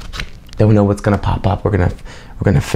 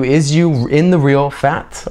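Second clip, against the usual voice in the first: slow literal gloss; 4 semitones higher, much slower than usual. Shown as a demonstration. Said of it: "spelling out literal meaning".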